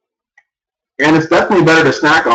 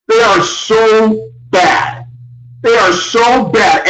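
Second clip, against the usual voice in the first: slow repeated verdict; 2 semitones higher, much slower than usual. Real, not acted.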